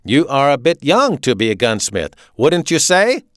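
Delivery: loud, high German officer; authoritarian, punched-out German accent; staccato German accent. A narrator's reading. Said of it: "he spoke with a staccato German accent".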